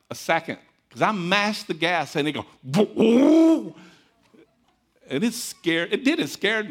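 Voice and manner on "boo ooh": loud high imitated vroom of the engine; engine roar. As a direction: mimic the motor taking off, loud. Vehicle engine roaring noise.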